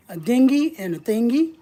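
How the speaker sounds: ordinary talk, nothing marked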